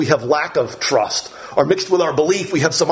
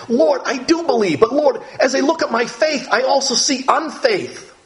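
humble plea; 8 semitones higher than usual. Retold or imitated. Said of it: "Just a humble man, a simple man".

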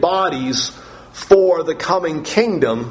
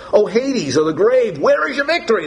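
exultant taunt; 6 semitones higher than usual. Acted. Dramatic scripture reading of taunt.

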